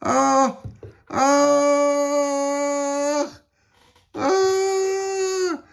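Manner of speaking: long, very high wails; acted cries. A narrator's reading. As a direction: wail high as if tossed about. Crew tossed by the wind.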